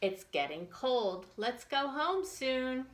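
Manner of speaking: ordinary delivery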